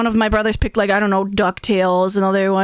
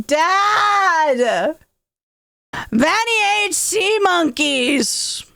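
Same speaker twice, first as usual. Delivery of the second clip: high, drawn-out tattling yell; calling out; 8 semitones higher, much slower than usual. Retold or imitated. Brother tells dad what Vanny ate, alarmed yell. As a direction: holler for dad in mock alarm.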